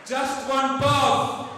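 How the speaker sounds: high, drawn-out calls; called-out provocations. Shown as a demonstration. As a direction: call each taunt out slowly to the crowd. Calls out provocations for crowd response.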